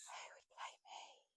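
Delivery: very soft, slow whisper; barely audible, drawn-out musing. Real, not acted. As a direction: whisper it slowly to yourself. Muses the question back to herself.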